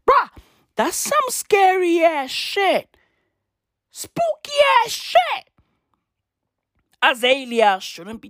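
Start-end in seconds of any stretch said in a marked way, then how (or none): 0.1-5.5 creeped out, climbing to a squeal; hammed-up spooked squeal; spooked reaction.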